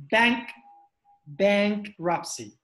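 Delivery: "bankruptcy" drawn out slowly in two parts, bank, then ruptcy; pronunciation model. Shown as a demonstration. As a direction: sound out "bankruptcy" slowly for learners, pausing after "bank".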